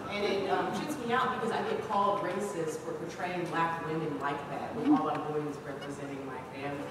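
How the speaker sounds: normal talking voice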